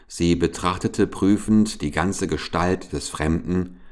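ordinary delivery

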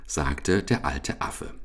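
usual speaking voice

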